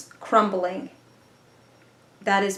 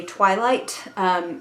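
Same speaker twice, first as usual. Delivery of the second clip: ordinary talk, nothing marked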